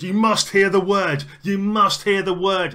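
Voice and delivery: high, slow, loud zealot's shout; proper shouting. Acted. Woman grabbing a stranger's arm, fanatical shouting. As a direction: drag it out and shout it at them. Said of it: "like, proper shouting".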